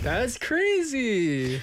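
drawn-out amazed high exclamation; surprised. Real, not acted. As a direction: stretch it out in disbelief. Shocked at old TikTok fame.

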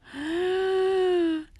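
sharp surprised gasp; a sudden high gasp of surprise; startled intake of breath. Real, not acted. Surprised at Chuseok date.

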